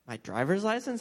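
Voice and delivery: high, rising, acted disbelief; retold baffled check with the officer; incredulous question. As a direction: ask it as if taken aback, pitch climbing. Driver asks officer, taken aback.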